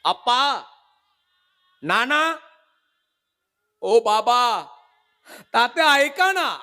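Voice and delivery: high, loud calls across the hall; a bride calling her father from the corner. Retold or imitated. Bride calling her father across the wedding hall, high pleading shouts. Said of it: "इकडून हाक मारते".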